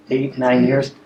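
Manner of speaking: ordinary talk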